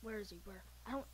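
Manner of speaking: ordinary talk, nothing marked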